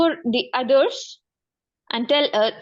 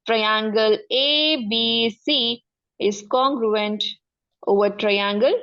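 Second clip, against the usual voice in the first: slow dictation; slower than usual. Shown as a demonstration. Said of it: "dictating the notation slowly".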